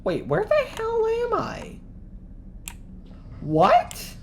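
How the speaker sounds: high startled yelp. Real, not acted. Gamer caught off guard, startled high cry. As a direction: jump in surprise, voice cracking up. Startled by sudden game event.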